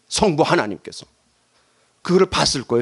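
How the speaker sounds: ordinary delivery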